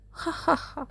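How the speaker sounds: short light ha-ha laugh; girlish laugh. A narrator's reading. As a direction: laugh proudly at her own story. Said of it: "वो बताकर हंसने लगी".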